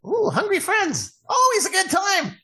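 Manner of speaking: high, drawn-out gnome squeal; perky high-pitched gnome hostess; excited gnome. Acted. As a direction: welcome them in a high, eager little voice. Gnome Sarah welcomes the guests.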